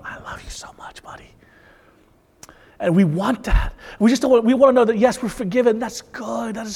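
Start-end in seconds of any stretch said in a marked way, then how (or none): 0.0-1.3 put-on tender whisper to a child. Father comforting crying son, whispered tenderly. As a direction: whisper it close into his ear. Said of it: "wrap my arms around him".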